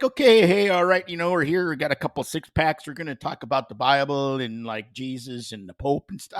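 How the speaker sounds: imitated Alberta accent, starting high and loud. Pope Leo talks Bible over six packs, imitated Alberta accent. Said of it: "had an Alberta accent".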